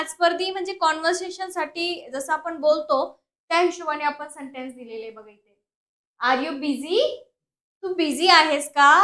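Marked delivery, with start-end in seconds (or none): 6.2-7.0 "are you busy" rising on busy; question tone. Shown as a demonstration. Teacher showing learners how a question sounds, question tone. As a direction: lift "busy" at the end as a real question. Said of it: "क्वेश्चनचा tone येणं गरजेचं आहे".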